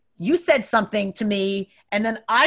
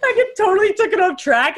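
real laughter breaking through, higher and louder; laughing; 11 semitones higher, 5 dB louder than usual. Real, not acted. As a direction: laugh so hard the words wobble. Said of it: "dying laughing".

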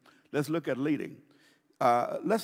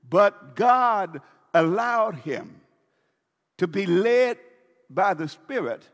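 preaching loud; 5 dB louder, slower than usual. Acted. Preaching at full force.

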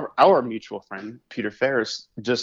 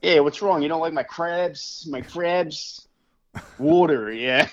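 higher, hammed-up Baltimore townie; Baltimore accent; 5 semitones higher, slower than usual. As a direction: mimic a Baltimore cop's accent. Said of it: "That, that mid-Atlantic accent".

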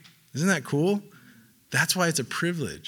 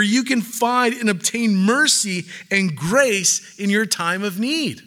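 emphatic preaching; 8 semitones higher, 5 dB louder, slower than usual. Acted. Quoting the writer, preaching.

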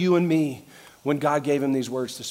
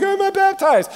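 mock complaint; 9 dB louder, slower than usual. Mocks a worried baptized person.

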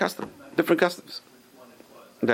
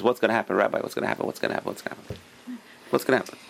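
fretful questions fading softer and softer; imitated anxious questioning; 4 semitones lower, 5 dB quieter, much faster than usual. A man forever asking his rabbi, imitated as anxious pestering. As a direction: keep pestering in a mild, fading mutter.

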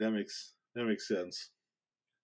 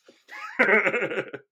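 loud burst of laughter; 10 semitones higher, 8 dB louder than usual. Real, not acted. Laughs at own punchline.

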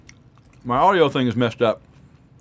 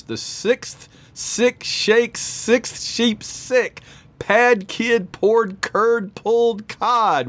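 slow, careful, higher tongue twister; 7 semitones higher, much slower than usual. Shown as a demonstration. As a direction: spell out each tricky word slowly. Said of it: "Tongue Twister Madness".

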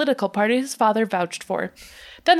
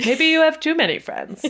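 imitating mom; 7 semitones higher than usual. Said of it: "my mother is always like".

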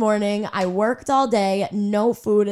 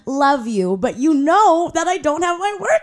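sweetly annoyed reply; 6 semitones higher than usual. Retold or imitated. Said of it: "And I'm like".

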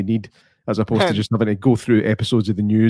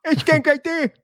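much higher, squeaky calling-out voice; high mock call; slower than usual. Co-host repeats the movie line in a squeaky mock call. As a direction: pitch it way up like a goofy caller.

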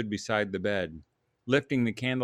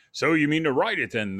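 higher, louder huff; huffing portly man; 5 semitones higher, 6 dB louder than usual. Acted. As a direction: huff it out like a stout editor. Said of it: "the portly man huffed".

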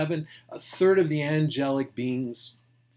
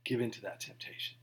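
hushed insistence; 2 semitones lower, 10 dB quieter than usual. Real, not acted. Pastor stressing Jesus' refusal.

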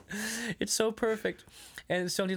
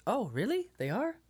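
mock laid-back; 9 semitones higher than usual. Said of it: "no matter how mad he was".

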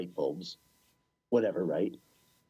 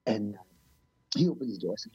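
ordinary talk

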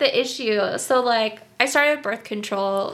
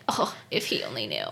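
much lower, softer wry aside with a laugh; 13 semitones lower, 7 dB quieter, faster than usual. Retold or imitated. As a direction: mutter it to herself, half laughing. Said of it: "And then I'm like".

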